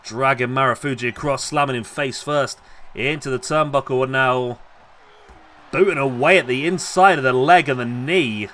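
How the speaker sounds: lively ringside wrestling call; wrestling commentator calling the match live; live play-by-play. Acted. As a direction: call every move as it lands.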